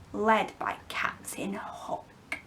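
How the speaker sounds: slow hushed whisper; ominous whisper. Acted. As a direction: whisper the name like a secret threat. Peter warns of pirates.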